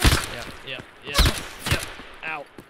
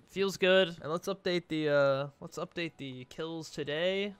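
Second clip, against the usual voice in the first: ordinary delivery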